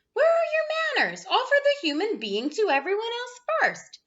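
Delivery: high scolding monster mum; scolding monster mother. A narrator's reading. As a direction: scold him shrilly, shocked by his manners.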